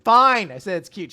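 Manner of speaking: high, loud burst of giving in; mock exasperated concession. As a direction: blurt it out as a cornered, hammed-up admission. Boy gives in to teasing.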